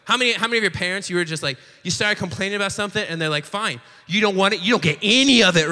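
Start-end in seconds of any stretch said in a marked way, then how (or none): 3.4-5.7 imitated fed-up parent, getting louder; lightly acted parental refusal, punched toward the end; grumpy parent. Parent answering a complaining child, mock grumpy. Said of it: "like, the grumpy parent".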